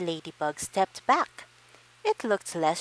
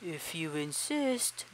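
slow quiet beetle voice; 6 dB quieter, slower than usual. Acted. Stag beetle accepts fight.

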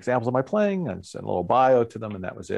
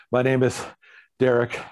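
halting, a word at a time between breaths; mock out of breath. Musician introducing himself after frantic audition dance, acted breathlessness. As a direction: puff out the name, imitating breathlessness. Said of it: "I'm totally out of breath".

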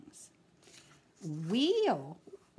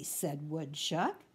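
ordinary delivery

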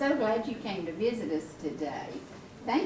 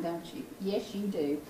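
soft, low cooing; tender cooing; 5 semitones lower, 6 dB quieter than usual. Real, not acted. Grandma to baby Xana, soft loving coo.

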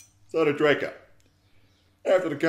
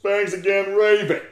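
gushing thanks; 6 semitones higher, 7 dB louder than usual. Acted.

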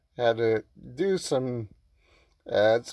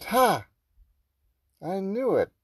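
high, loud triumphant crow; triumphant gotcha; 8 semitones higher, 5 dB louder than usual. Acted. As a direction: pounce on the catch in triumph. Character catches player cheating.

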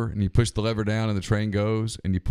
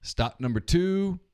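slow, higher conductor call; 7 semitones higher, slower than usual. Retold or imitated. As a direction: drag out the stop call. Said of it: "pulls it back up".

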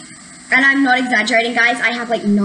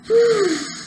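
pained sigh; 9 semitones higher than usual. Real, not acted. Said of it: "it's still burning".

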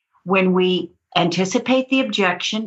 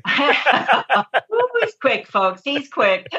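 laughing; 3 semitones higher, much slower than usual. Real, not acted. Laughing at host's quip.

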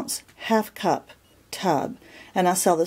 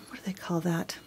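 whispered aside; 9 dB quieter than usual. Real, not acted. Trying to recall a word.

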